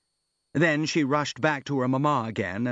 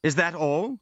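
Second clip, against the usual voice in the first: higher-pitched, unabashed question; mildly astonished, without shame; frank surprise; 6 semitones higher than usual. A narrator's reading. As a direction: ask it openly surprised. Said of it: "loudly and frankly surprised and quite unblushing".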